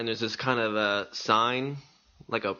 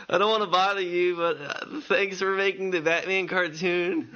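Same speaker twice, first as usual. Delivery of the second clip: starstruck squeaky voice; 8 semitones higher than usual. Retold or imitated. Fan nervously thanking his cartoon idol, starstruck high voice. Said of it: "my voice is doing this".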